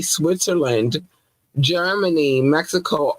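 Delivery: normal talking voice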